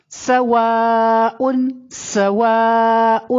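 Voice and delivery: سواء with a long-held aa; long madd. Shown as a demonstration. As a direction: hold the aa of سواء very long on a level note. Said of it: "اس کو خوب کھینچیں گے". (سواء کا الف خوب کھینچ کر)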